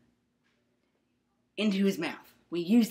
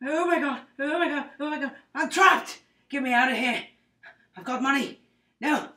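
higher, louder mock-panicked pleading; hammed-up panic; 7 semitones higher, 7 dB louder than usual. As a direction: overplay a trapped patient begging to escape. Patient trapped before surgery.

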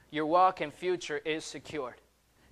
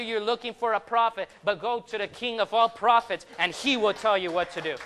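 raised, insistent preacher's delivery; forceful preaching; 5 semitones higher, 5 dB louder than usual. Real, not acted. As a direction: drive the message home to the congregation.

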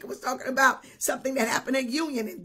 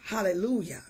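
whispered hallelujah; 7 semitones lower, faster than usual. Real, not acted. Whispered praise.

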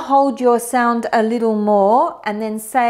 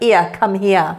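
Cockney "ear, come here"; 4 semitones lower than usual. Shown as a demonstration. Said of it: "an English person with a Cockney accent saying".

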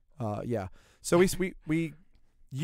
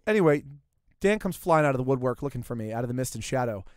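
ordinary talk

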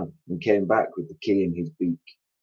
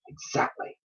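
whispered agreement; 11 dB quieter than usual. A narrator's reading. Mr Twit agrees.

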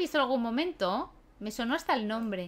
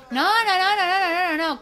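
much higher, louder rapid no no no; frantic protest; 6 semitones higher, 7 dB louder than usual. Real, not acted. Player frantically protesting at a stuck game. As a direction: panic as it goes wrong.

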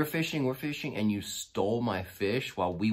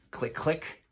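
higher mouth clicks for a gun cocking; rifle clicks imitated; 9 semitones higher than usual. Behind the speaker, imitating a rifle being cocked with higher clicks. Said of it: "I hear".

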